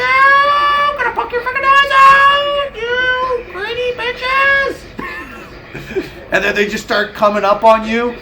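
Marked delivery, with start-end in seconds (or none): 0.0-4.8 very high, slow cartoon squeal of menace; vengeful turkey. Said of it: "And they're like". 5.0-6.3 breathy giggle. Real, not acted. Laughs at own bit.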